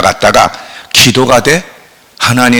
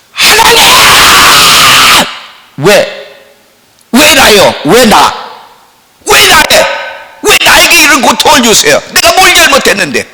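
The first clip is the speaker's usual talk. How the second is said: crying out to God; 10 semitones higher than usual. Retold or imitated. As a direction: hurl the questions up at God in despair. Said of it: "소리를 질렀어". (하나님께 원망하며 높은 목소리로 외치는 절규)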